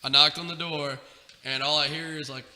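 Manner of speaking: ordinary delivery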